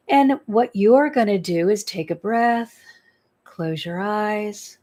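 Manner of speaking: calm guided breathing instructions, unhurried; guided meditation. Acted. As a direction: lead them gently into the breath and the earth. Leads breathing and grounding.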